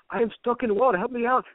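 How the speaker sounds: high, put-on Indian accent. Trapped woman asking to be let out, high mock Indian accent. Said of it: "I feel like I'm from India now".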